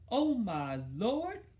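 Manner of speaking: slow, loud, high cry; scream. A narrator's reading. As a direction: draw it out, a horrified mother. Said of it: "Mama screamed".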